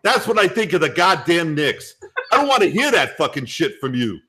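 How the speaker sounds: cocky New York sports fan ranting about the Knicks; New York guy. Acted. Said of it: "I'm gonna do my New York guy".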